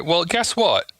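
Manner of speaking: ordinary talk, nothing marked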